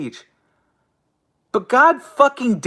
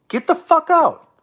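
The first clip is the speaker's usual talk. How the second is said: higher, stress on "the" in "Get the fuck out."; angry repeat; 8 semitones higher than usual. Real, not acted. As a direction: throw it back at chat, pitched up. Streamer ranting at chat.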